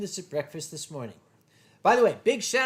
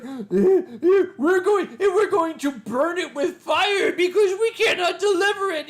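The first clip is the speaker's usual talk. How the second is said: high, loud laughing mock voice; mock UPS clerk; 11 semitones higher, 6 dB louder than usual. UPS clerk mocked to friends, high and laughing. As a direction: ham up a clueless delivery clerk, much higher. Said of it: "And U P S is like".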